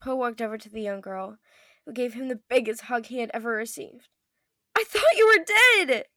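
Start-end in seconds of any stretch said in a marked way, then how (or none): 4.8-6.1 high, loud, slow cry of disbelief; loud tearful cry. Acted. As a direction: cry it out, much higher and louder. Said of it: "Nora cried loudly".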